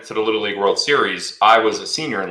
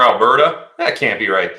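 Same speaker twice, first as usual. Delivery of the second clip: usual speaking voice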